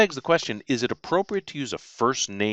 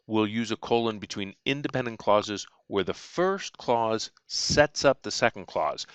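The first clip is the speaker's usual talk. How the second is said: ordinary talk, nothing marked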